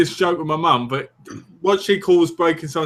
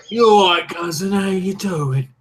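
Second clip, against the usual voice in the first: hearty East End greeting; overacted East End accent; 2 semitones higher than usual. Greeting a cousin in an overacted East End accent. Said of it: "Overact the East End accent".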